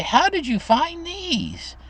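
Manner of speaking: put-on high, slow, wondering old lady; sweet old grandma. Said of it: "and she'd say".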